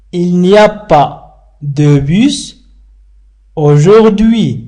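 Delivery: very slow, word by word for learners; slow model sentence. Shown as a demonstration. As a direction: spell out Il n'y a pas de bus aujourd'hui slowly. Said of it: "dialogue line modelled slowly".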